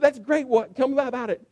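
high and excited; eager. Retold or imitated. Pastor eager for the story.

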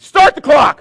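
very loud, high barked command; shouted order. Retold or imitated. Preacher ordering the basketball officials, shouting. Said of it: "I'm yelling".

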